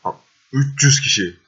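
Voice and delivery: loud disbelieving outburst; exasperated shout. Real, not acted. Gamer seeing three hundred enemies coming, loud disbelief. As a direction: throw it out loud at the huge army.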